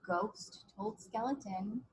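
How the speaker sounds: put-on slow, paced read-aloud for little kids; storybook reading. A narrator's reading. Teacher reading a picture book to kids, gentle storytelling. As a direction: read each line like a page turn.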